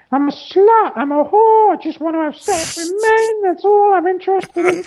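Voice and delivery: silly screeching falsetto; very high, hammed-up comedy mother voice; shrieked self-mockery in a squawking falsetto; Brian's mother. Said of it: "in the voice of Brian's mother".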